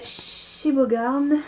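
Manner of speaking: Shibogarn spelled out slowly, syllable by syllable, a careful try at German; hesitant German brand name read slowly; attempted German pronunciation. Real, not acted. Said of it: "je ne connais pas l'accent, ... je m'excuse d'avance".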